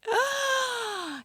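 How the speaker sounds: sharp, high intake of breath; mock shocked gasp. Ex-boyfriend hearing her bad French, mock shocked gasp. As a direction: gasp as if appalled. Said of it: "he'd be like".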